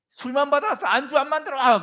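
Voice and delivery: high-pitched, scolding yell; hammed-up angry tyrant; demanding master. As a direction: bellow orders at a useless servant. Master bellows orders at servant.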